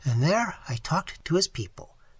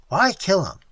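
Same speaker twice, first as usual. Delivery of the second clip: loud, higher broken-English question; imitated Seminole man; 4 semitones higher, 9 dB louder than usual. Waitress imitates Tigertail asking about the killing, insistent. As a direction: demand a reason in his voice. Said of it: "even imitating his voice and gestures".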